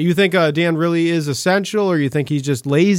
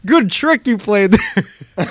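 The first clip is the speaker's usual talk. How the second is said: sarcastic; 5 semitones higher, much slower than usual. Acted. Mock praise after the prank.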